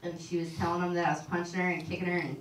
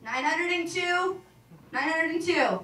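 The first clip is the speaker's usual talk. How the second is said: put-on slow, drawn-out, higher and louder kid guess; excited kid guess; 8 semitones higher, 5 dB louder, slower than usual. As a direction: proudly announce the wrong number like an eager kid. Kid excitedly guessing jelly beans.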